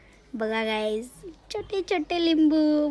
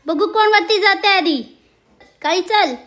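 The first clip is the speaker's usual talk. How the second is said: loud, high cheering on; urging on; 7 semitones higher, 5 dB louder than usual. Real, not acted. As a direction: egg the racers on at raised pitch. Urging cats in climbing race.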